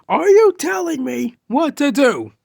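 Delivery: high, loud, outraged challenge; shouting. Acted. Hothead challenging a classmate who advised him, shouting. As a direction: shout it as an affronted demand. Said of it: "You don't have to shout at me".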